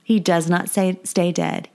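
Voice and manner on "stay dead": ordinary talk, nothing marked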